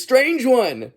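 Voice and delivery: high-pitched mock call; imitated call. Wizard tossing the chainsaw down to Ash, imitated high call. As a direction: call it out like the film character. Quotes character calling down to Ash.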